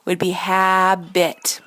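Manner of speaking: habit said slowly and loudly, split hab-it; syllable split. Shown as a demonstration. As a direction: show the right split slowly. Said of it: "the right way that we would do this".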